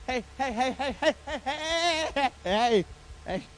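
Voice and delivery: hammed-up motherly interruption; fussing mom. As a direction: play a mom breaking up a commotion. Mom comes downstairs at the commotion.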